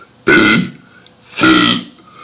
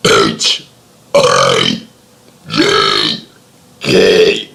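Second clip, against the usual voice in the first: burped letters, deep and croaky; burping the words; 14 semitones lower, much slower than usual. Real, not acted. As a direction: belch each letter out. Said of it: "I'm gonna be burping the A B Cs".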